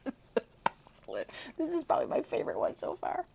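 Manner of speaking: quick high gushing praise; delighted aside. Real, not acted. Reader praising the story, quick delighted gush. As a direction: rave to the listeners.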